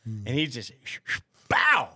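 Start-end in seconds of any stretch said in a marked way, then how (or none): 1.5-1.9 loud, high "bow" of impact; punch sound effect. Retold or imitated. Teller acts out the one liver punch, explosive sound effect. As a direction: burst it out like a landed punch.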